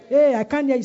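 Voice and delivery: rally the crowd for a bigger response; calling out. Real, not acted. Rallies congregation for louder response.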